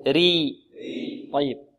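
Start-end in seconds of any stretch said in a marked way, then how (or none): 0.1-0.6 thin, light r on ري; drawn-out ري with a thin r. Shown as a demonstration. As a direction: model ري lightly, for the class to repeat. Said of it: "ترقق".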